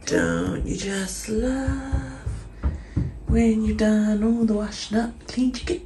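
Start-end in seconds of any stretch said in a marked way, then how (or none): none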